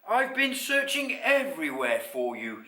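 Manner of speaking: relieved mother. A narrator's reading. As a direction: exclaim to a found child. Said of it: "she said".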